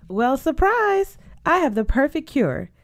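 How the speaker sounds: high, gleeful announcement; spring the surprise with pitch lifted high; excited reveal. Acted. Berta springs a cure for homesickness, excited reveal.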